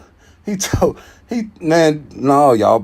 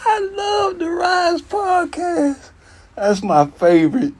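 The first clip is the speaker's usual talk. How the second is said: much higher, slow, sing-song praise; mock gushing; slower than usual. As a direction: gush like a superfan. Exaggerated gushing.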